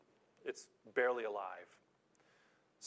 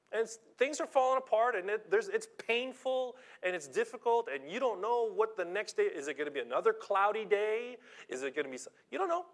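rising preaching cry; 9 semitones higher, 6 dB louder than usual. Real, not acted. Preacher builds to a climax.

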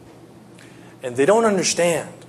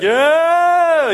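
high, loud, drawn-out imitation yell; mock pained yell; 14 semitones higher, 6 dB louder than usual. Patient getting his broken finger set, mock pained yell. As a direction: act out a long wail of pain. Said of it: "It was painful".

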